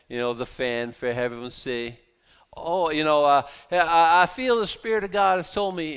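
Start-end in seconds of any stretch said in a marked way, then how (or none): 2.6-6.0 louder, higher Southern preacher parody; lightly hammed Southern accent, pushed louder; mock Southern accent. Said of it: "we used to do a Southern accent when we do that".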